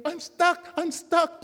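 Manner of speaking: a trapped little creature begging; high squeaky panicked pleading; little voice stuck. A narrator's reading. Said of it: "there's this voice saying".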